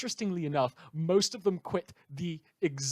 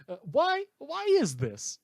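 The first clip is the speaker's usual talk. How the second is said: mock bafflement; 12 semitones higher, 4 dB louder than usual. Mock bafflement at double standard.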